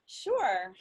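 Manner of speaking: usual speaking voice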